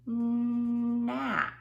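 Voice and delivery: gnat stretched and sung out high and loud; sounding out the word. Shown as a demonstration. Teacher models the word for kids, stretched out. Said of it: "This word is gnat".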